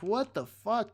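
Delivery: high, shocked exclamation; incredulous outburst. Retold or imitated. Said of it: "I'm like".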